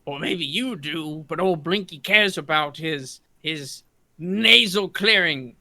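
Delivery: lightly voiced indignant character; drawn-out, mildly affronted character voice; a touch of huffy role-play. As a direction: start measured, build to a loud, high finish. Game master plays Blinky arguing.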